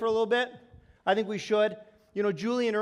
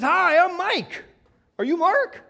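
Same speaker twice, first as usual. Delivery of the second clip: higher, louder, hearty voicing of the driver; friendly driver greeting; 8 semitones higher, 6 dB louder, slower than usual. Speaker voicing the driver Mike greeting him, higher and louder. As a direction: greet them like a cheerful big driver.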